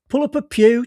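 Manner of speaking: a breezy senior detective waving him over; jovial boss calling out. Acted. Chisholm voiced in the story.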